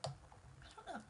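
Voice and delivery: tearful, half-laughing whisper; crying. Real, not acted. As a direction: whisper through tears. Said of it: "Now my nose is running".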